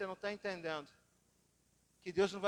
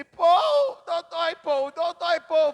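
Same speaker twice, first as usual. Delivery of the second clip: put-on very high, loud wailing child; crying toddler; 9 semitones higher, 14 dB louder, much slower than usual. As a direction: wail like a shocked little kid. Shocked toddler crying to dad.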